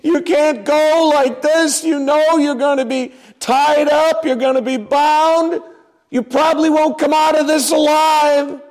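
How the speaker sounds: high, begging cry that swells to a shout at the end; pleading friends. Retold or imitated. Friends begging Paul not to go to Jerusalem, high desperate pleading. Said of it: "People come".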